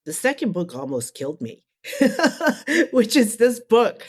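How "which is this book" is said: real laugh breaking into the words. Real, not acted. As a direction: laugh it off, then higher and louder. Laughs at her exhausting book.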